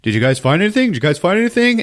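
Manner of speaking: high questioning call, climbing; radioing guard. Acted. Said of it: "over the radios".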